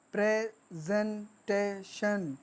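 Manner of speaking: Presentation slowly in four separate beats; four-syllable split. Shown as a demonstration. Teacher counting four sounds, presentation broken syllable by syllable. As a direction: pause between pray, जें, टे, शन. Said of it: "चार sound".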